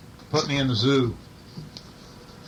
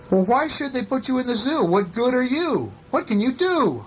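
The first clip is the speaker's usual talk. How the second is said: put-on child's voice; 8 semitones higher than usual. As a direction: squeak it up like a cheeky little boy. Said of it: "Hunter says to the dog".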